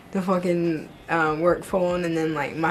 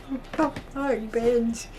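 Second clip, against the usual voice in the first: higher, disbelieving what the fuck; incredulous; 4 semitones higher, 4 dB quieter, slower than usual. Real, not acted. Retelling a creepy hotel guest, incredulous and higher. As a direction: recoil in disbelief at a creepy request.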